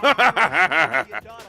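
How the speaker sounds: high mocking cackle; evil laugh. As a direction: cackle as you leave. Villain's parting threat.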